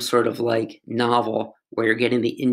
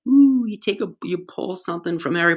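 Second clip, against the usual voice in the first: mock magical ooh; 7 semitones higher than usual. Evoking Harry Potter memory magic.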